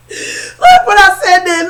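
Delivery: high, loud, breaking with laughter; laughing. Real, not acted. Telling viewers what set her off, laughing. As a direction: try to talk through the giggles. Still recovering from laughing fit.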